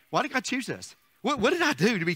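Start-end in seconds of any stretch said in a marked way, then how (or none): none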